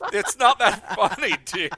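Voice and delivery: high, loud, words squeezed through laughter; laughing. Real, not acted. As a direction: protest while helplessly laughing. Still laughing at the voice.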